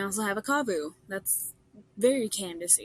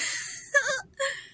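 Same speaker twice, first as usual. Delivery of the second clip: high cracking laugh. Real, not acted. Laughing at own exasperation.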